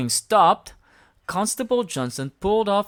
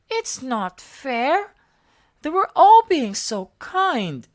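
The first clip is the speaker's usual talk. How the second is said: high, slow then louder protest; upset Elmo; 10 semitones higher, 7 dB louder, slower than usual. A narrator's reading. Elmo upset at the story's ending, high and upset. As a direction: complain to daddy.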